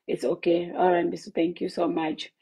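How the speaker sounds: usual speaking voice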